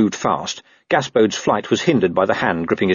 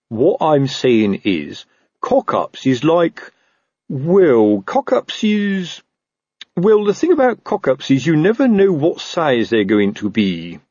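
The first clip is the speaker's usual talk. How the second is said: slow, halting, higher-pitched sergeant; floundering Sergeant Colon; 6 semitones higher, slower than usual. Acted. Sergeant struggling to explain cock-ups, slow and floundering. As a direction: grope for the words, stretching them out.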